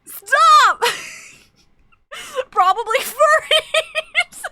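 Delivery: high squealing laughter; breathless high laughing; laughing fit. Real, not acted. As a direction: crack up mid-word. Laughing fit at own joke.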